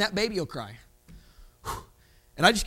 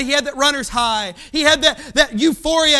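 usual speaking voice

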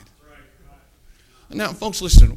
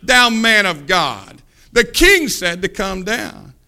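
slow, loud, condescending summons; derogatory; 7 dB louder, slower than usual. Acted. Captain looking down on the man of God, derogatory. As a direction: stretch each phrase out with loud contempt. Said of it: "he said it in a derogatory tone".

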